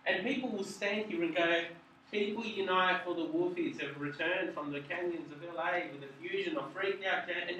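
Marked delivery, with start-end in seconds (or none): 2.1-7.5 monotone robot read. Shown as a demonstration. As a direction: read it flat like a robot, one sound. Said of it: "they just read it like a robot".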